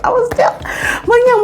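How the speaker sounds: high giggling repeat; giddy high echo; laughing squeal. Real, not acted.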